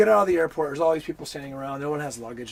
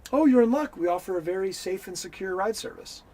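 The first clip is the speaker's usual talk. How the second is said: mock salesman pitch; 5 semitones higher, 5 dB louder than usual.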